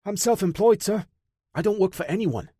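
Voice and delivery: high, cheeky smart-mouth voice; wisecracking courier. A narrator's reading. Beaten courier mocking his interrogator, high cocky voice. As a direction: play it cocky and flippant.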